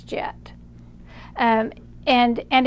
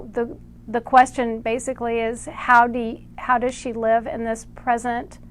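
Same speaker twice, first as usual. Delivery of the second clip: ordinary delivery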